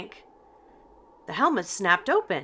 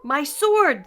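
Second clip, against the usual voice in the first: high, loud dismayed cry; knight's cry; 7 semitones higher, 6 dB louder than usual. A narrator's reading. Knight knocked over by Shaggy and Scooby, a high loud cry. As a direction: cry out as you lose your sword. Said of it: "the knight cried".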